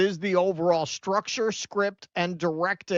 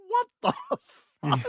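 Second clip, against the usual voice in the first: high-pitched mock shock, breaking into a laugh; retold astonishment; faster than usual. Host retells first hearing an actor's real accent, astonished. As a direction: squeal it in laughing disbelief.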